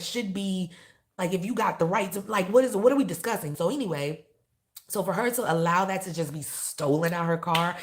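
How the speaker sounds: loud, high indignation; emotional rant. Real, not acted. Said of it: "getting emotional".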